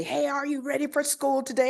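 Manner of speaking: put-on high, quick, upbeat greeting to kids; cheery morning call. Principal rousing her students at morning flag salute, high quick cheer. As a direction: pump the class up like every morning. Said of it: "and then I'll say".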